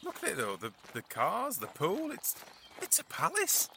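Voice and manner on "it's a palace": high, amazed squeak; awed. Acted. Cleaner awestruck at the rich house, voice shooting up. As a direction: gasp it out in wonder.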